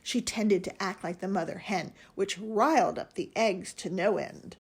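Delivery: plain reading voice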